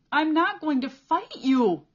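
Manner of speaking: high, loud, slow defiant shout; pained shout. A narrator's reading. Cole refuses to hit back, shouting under kicks. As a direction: hold your ground, shouting. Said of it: "Cole shouted".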